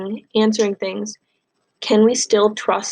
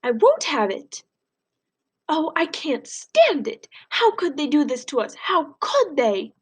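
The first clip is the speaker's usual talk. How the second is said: higher, slow, distressed grandma voice; grandma fearing for her family, high and slow; upset grandmother; 5 semitones higher than usual. Acted. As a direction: plead it slowly as a frightened old woman. Grandma's distressed lines in the novel.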